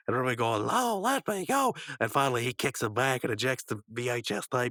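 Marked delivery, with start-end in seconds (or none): 0.7-1.7 high, drawn-out mock wail; pleading protest. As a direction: beg to be released, stretched high. Rigby begs to be released.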